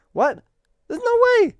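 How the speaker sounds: shocked disbelief. Real, not acted. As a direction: squeal it as if you can't believe your ears. Disbelief at soda-drinking fit people.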